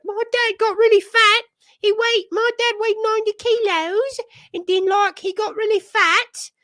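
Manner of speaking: put-on very high, loud girlish Australian voice; hammed-up impression of a small Australian girl; little Australian surfer girl. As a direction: squeal it like an excited Aussie kid. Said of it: "the little girl who's being interviewed".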